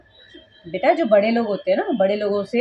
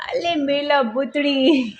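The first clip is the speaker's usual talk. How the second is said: doting coo; 4 semitones higher, much slower than usual. Real, not acted. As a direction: cuddle him with your voice. Mother cooing to her son. (बेटे को प्यार से धीरे धीरे पुचकारना)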